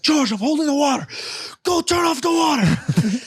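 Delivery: high urgent shout; dad shouting up the stairs, high and urgent; dad yelling. Retold or imitated. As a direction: yell the order over gushing water. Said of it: "He's like".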